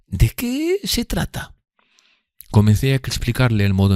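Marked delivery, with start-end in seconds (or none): 0.1-1.5 very high, drawn-out question; questioning Miss Havisham. Acted. Miss Havisham asking Pip about his friend, high and slow. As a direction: ask slowly in a high lady's voice.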